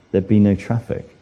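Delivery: normal talking voice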